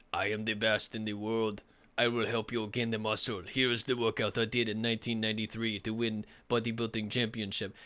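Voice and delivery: badly faked Austrian accent; Arnold Schwarzenegger. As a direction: do a terrible Austrian bodybuilder accent, hammed-up. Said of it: "worst accent I've ever heard for Arnold".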